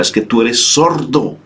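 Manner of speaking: put-on a resentful child calling God deaf; mock reproach. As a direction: scold God for not listening. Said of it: "reprochamos al Señor de una manera".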